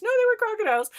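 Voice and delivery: put-on high child correction; insistent kid self. Kid correcting mom about crocodiles, high and stubborn.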